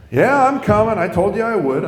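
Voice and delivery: loud, high, put-upon answer; irritated phone reply. Retold or imitated. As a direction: snap back at a nagging caller.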